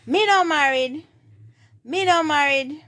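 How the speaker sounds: high, loud Jamaican Patois demo. Shown as a demonstration. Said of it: "in patois, you would say".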